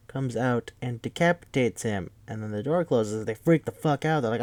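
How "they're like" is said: ordinary talk, nothing marked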